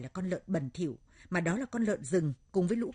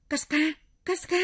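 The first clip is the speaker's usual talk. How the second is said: high, alarmed shout of a name; urgent high call; crying out; 9 semitones higher, 6 dB louder than usual. Acted. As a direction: shout to wake a friend. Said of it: "Tôi bèn hô hoán".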